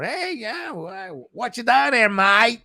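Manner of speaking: high-pitched mock Australian accent; overt Australian accent. Host showing which Australian accent he can't stand, overt Australian accent. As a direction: ham up a broad Australian "mate" greeting. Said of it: "Whereas kinda like the more".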